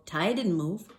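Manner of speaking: plain reading voice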